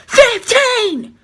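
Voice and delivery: imitated full-force high shout; yelling in the ear. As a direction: mimic an examiner bellowing at close range. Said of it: "in my ear".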